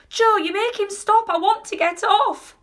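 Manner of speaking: high, loud, panicky demand; yelling. A narrator's reading. Grandma begs her husband to halt the elevator, yelling high. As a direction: yell over a deafening noise. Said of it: "yelled Grandma Josephine".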